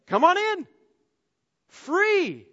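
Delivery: very high and loud, ringing out; jubilant invitation. Acted. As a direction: fling the door open. Jesus inviting through torn curtain.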